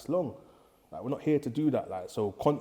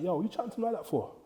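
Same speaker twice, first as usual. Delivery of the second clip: quick, higher-pitched scolding dad impression; 8 semitones higher than usual. Dad scolding his cheeky son in front of police, quick and higher. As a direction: rattle off a father's telling-off. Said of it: "my dad's like".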